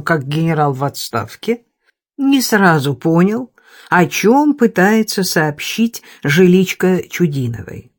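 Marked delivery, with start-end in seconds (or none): none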